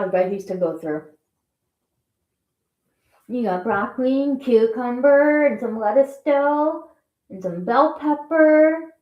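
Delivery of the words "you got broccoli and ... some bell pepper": higher sing-song pet voice; talking to pets. Real, not acted. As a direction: coo at the piggies, higher. Talking to her guinea pigs.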